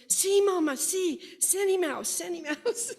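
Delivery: put-on a toddler shouting to his mama that he was right; excited toddler. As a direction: squeal it like a thrilled toddler. Toddler excitedly recognizes the song.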